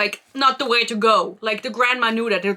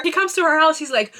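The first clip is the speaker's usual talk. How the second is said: ordinary talk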